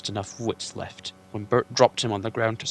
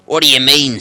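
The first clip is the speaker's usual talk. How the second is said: troll Bert; 4 semitones higher, 16 dB louder than usual. Acted.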